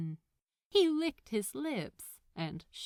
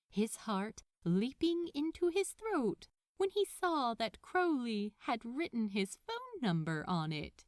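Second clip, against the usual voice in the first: usual speaking voice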